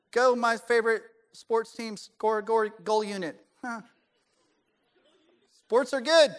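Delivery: badly faked sports fan; trying to sound sporty. Imitating people-pleasers around sports friends, clueless fake sporty. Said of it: "try to sound sporty".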